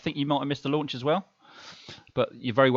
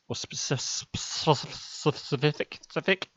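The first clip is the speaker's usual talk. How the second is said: tongue-tied stumble; 4 semitones higher, 3 dB quieter than usual. Real, not acted. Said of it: "I can't even talk today".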